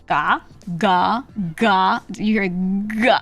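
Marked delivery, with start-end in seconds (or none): 0.1-2.0 "ga" as a heavy, voiced English g; tense the throat into a hard "ga". Shown as a demonstration. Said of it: "if I was doing English".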